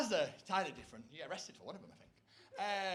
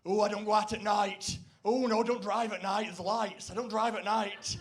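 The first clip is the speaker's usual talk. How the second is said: village friends; 2 semitones higher, 8 dB louder than usual. Retold or imitated. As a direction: play the nervous stay-at-home villager. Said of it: "they'll say things like".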